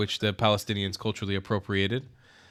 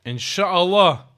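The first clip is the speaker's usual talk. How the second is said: slow, fervent Inshallah, louder and higher; drawn-out inshallah; 6 semitones higher, 8 dB louder, much slower than usual. Real, not acted. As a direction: stretch it out like a blessing. Fervent prayerful response.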